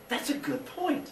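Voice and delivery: high, slow goofy Father Sky voice; high slow silly voice. Retold or imitated.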